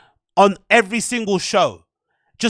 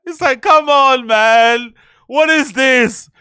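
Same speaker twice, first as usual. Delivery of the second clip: slow, louder disbelieving come on; exasperated; 3 semitones higher, 5 dB louder, slower than usual. Real, not acted. Streamer scolding the podcaster, louder and slower. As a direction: drag it out in disbelief.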